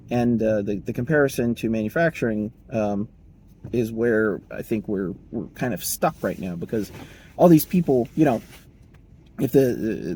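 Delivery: ordinary talk, nothing marked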